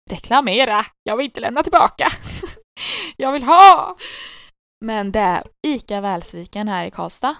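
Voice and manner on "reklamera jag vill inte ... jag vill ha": high, loud playful outburst; loud mock protest. Host jokes about wanting to keep it, loud mock protest. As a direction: protest loudly like a stubborn customer. Acts out refusing to give back.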